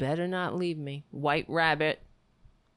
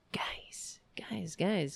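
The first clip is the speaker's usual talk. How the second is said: whisper; 7 dB quieter, much slower than usual. Real, not acted. Whispering to the chat.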